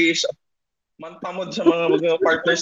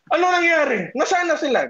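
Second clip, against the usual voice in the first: mock angry demand; 8 semitones higher than usual. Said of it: "galit na galit ka".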